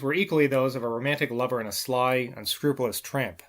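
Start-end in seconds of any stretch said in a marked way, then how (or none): none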